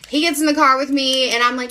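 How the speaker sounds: ordinary talk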